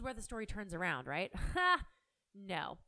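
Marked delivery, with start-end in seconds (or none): 1.5-1.9 loud high burst of laughter; one quick loud ha; sharp laugh. Real, not acted. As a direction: bark out a laugh at your own joke. Laughing at own joke.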